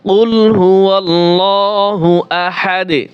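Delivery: slow, sustained recitation with long held syllables; Quran recitation. Shown as a demonstration. Teacher reciting Surah Ikhlas as an example, slow measured recitation. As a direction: recite slowly and evenly, bouncing the final d. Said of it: "সূরা ইখলাস পড়ার সময় কি পড়ি".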